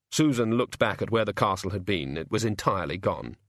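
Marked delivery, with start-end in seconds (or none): none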